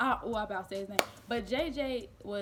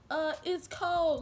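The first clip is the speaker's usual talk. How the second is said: acted complaint; 7 semitones higher, 5 dB louder, slower than usual. Acting her reluctance to go outside.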